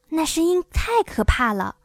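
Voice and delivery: usual speaking voice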